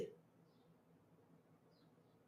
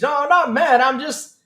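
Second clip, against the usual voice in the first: higher, insisting he's not mad; protesting not mad; 7 semitones higher, 4 dB louder than usual. Real, not acted. Streamer telling chat he's not mad, protesting a bit too loudly. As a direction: brush off the anger, too eager. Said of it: "That's what I'm shocked about".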